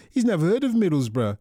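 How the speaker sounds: normal talking voice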